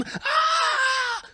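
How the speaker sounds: long, very high scream; mock horrified scream. Comedian reacts to two dudes hugging a kid, screaming. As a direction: scream your head off, hammed up. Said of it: "I'm screaming".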